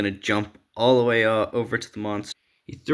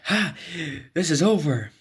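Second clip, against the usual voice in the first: much higher, triumphant villain cry; the Joker declaring victory; gloating Joker; 7 semitones higher than usual. Acted. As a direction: crow over the heroes.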